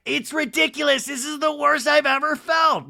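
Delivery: a hammed-up Kyle sulking; mock Kyle. Host mocking Kyle's talking head, whiny. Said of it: "Kyle's like".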